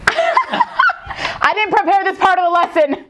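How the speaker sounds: high, slow, laughing repeat. Real, not acted. Teacher repeating her flustered line, laughing.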